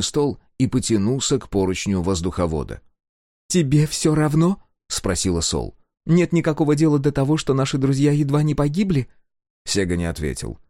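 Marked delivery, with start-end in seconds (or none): 3.5-4.6 higher, reproachful question; reproachful Sol. A narrator's reading. Sol reproaching silent Sega, higher and hurt. 6.0-9.1 higher, reproachful question; reproachful Sol. A narrator's reading. Sol reproaching silent Sega, higher and hurt.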